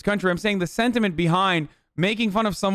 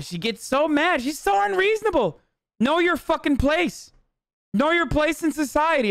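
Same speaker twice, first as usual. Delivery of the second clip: higher, sneering impression of a condescending bigot; mocking bigot voice; 7 semitones higher than usual. Mocking racists' attitude toward Kamala.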